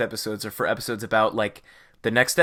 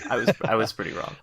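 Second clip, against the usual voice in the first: laughing, quick self-mocking admission; 2 semitones lower, faster than usual. Real, not acted. Co-host admits a wrong guess, laughing.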